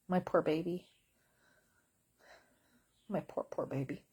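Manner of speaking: soft, pitying coo to the dog; tender cooing. Real, not acted. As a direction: murmur to a sick pet. Cooing over her sick dog.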